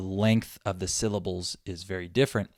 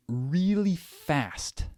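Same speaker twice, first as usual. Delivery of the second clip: REALLY drawn out and raised before FAST; emphatic stretch; 7 semitones higher, much slower than usual. Shown as a demonstration. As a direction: stretch REALLY high, then land on FAST. Emphasizing word in rhythm lesson.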